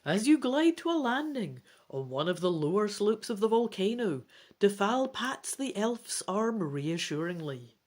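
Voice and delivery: steady read-aloud storytelling; narrating a dragon adventure to the family; story narration. A narrator's reading. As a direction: read it like a bedtime adventure book. Narration resumes.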